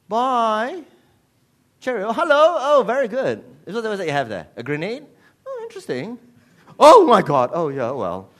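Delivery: high, breezy English calling out; mock English parent. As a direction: greet the child with hammed-up English politeness. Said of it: "You do the English thing".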